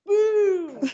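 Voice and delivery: playful shouted cannon boom. Pretend. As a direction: fire off the cannonball with a big boom. Imitating cannonball in pirate game.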